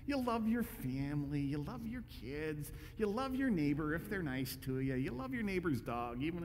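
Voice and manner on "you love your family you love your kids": usual speaking voice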